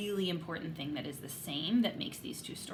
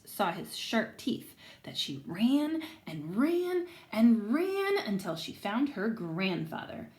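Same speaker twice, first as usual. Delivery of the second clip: lively read-aloud to a class; story reading to children; 3 semitones higher than usual. Acted. Teacher reading aloud to her class, lively storytelling. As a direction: tell the story to the kids, voicing everyone.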